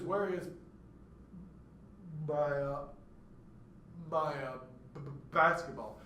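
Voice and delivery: long gaps round "my", repeated "b" on basketball; demonstrated halting stutter; two stutters demonstrated. Shown as a demonstration. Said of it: "that's two stuttered events".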